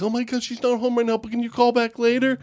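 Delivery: high flustered mom voice; excited mother. Retold or imitated. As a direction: gush like a starstruck mom. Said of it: "the mother would be like".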